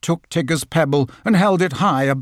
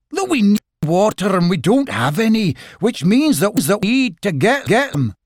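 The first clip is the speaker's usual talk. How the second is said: higher, louder, tripping over words; flustered rabbit; 6 semitones higher, 4 dB louder than usual. Acted. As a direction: hurry and stumble with urgency.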